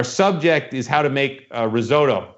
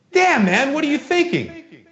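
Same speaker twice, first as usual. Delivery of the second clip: high mock yell; half-hearted imitation of a yell; a retold golf course yell; 13 semitones higher, slower than usual. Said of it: "That's the exact words I yell".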